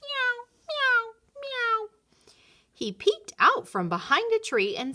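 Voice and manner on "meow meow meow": very high little mews; kitten meows. A narrator's reading. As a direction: mew like a tiny kitten. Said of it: "he heard a meow".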